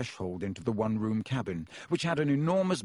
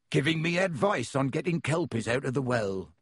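usual speaking voice

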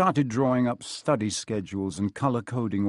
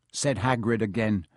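ordinary delivery

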